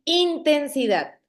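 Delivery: intensidad with the stress wrongly on IN, INtensidad; stress on first syllable. Shown as a demonstration. Teacher testing a student's guess, intensidad stressed on IN. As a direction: hit the opening IN of intensidad, then fall away. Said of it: "en la primera I, sonara así".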